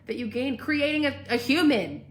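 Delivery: yelling. Real, not acted. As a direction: get fired up and push it out. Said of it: "Now I'm yelling".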